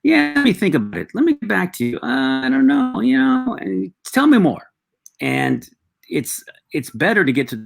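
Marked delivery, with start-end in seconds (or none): 0.0-4.7 high-pitched, noncommittal customer voice; stalling prospect. Acted. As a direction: put on a high voice and dither.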